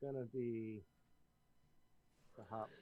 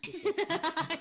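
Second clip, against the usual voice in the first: laughing; 8 dB louder than usual. Real, not acted. Laughing refusal, high loud.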